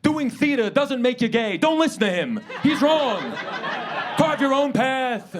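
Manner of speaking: high, loud, impassioned mock exhortation; Bernie Sanders. Cool cousin urging you to break free, mock-fervent shouting. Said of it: "your cool cousin trying to break you free".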